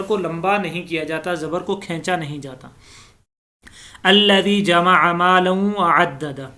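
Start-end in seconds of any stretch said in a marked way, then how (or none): 4.0-6.5 slow chanted tajweed reading; measured Quranic chant; slow recitation. Shown as a demonstration. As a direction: recite the verse slowly and evenly. Recites verse correctly.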